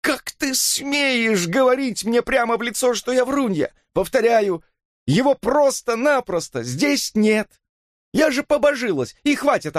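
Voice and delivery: high, drawn-out indignant tirade; furious outcry. A narrator's reading. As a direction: scold in a very high, outraged voice. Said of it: "вскричала Хемингуэй, яростно выпятив бюст". (яростно и возмущённо, высоким голосом)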